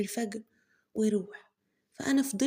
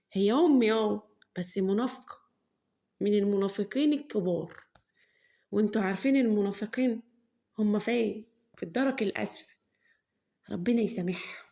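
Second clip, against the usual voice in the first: ordinary delivery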